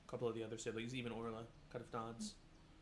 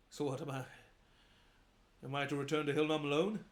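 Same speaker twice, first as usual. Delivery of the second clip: upset louder protest; 2 semitones higher, 7 dB louder than usual. Acted. Son upset at returning alone.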